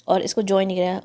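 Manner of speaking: ordinary delivery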